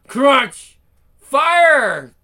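loud high barks; combat shouts. Acted. Soldiers and Ash in battle, loud high shouts. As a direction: yell them out in the fight.

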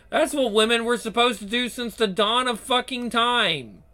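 ordinary delivery

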